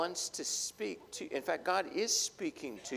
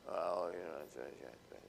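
much lower, softer, trailing mumble; sheepish fumbling; 11 semitones lower, 10 dB quieter than usual. Retold or imitated. Husband caught by his wife, mumbling sheepishly. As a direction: stall while faking an answer.

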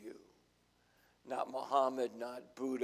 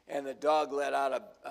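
normal talking voice

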